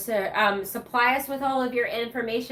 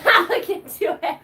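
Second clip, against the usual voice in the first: laughing echo; 9 semitones higher, 7 dB louder than usual. Real, not acted. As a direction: repeat it through a real laugh, pitched up. Echoing a friend's quip, laughing.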